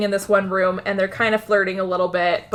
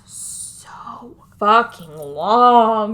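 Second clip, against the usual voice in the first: very slow, stretched words with whispery start; exasperated, lingering emphasis; dragged-out complaint; much slower than usual. Real, not acted. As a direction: draw every word out to show the tedium. Complains the scene drags on.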